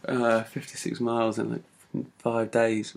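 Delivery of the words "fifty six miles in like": usual speaking voice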